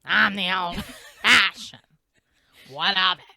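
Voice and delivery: personified donut voice. Acted. Cohost imagines how the donut would talk, cranky character voice. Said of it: "her voice would be like".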